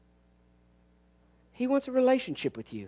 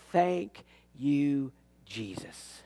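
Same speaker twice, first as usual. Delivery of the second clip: quiet thanks; 7 semitones lower, 7 dB quieter, slower than usual. Real, not acted.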